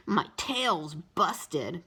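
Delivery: put-on slow, whiny little boy; complaining. A narrator's reading. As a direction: drag it out like a sulky boy. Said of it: "complained Howie".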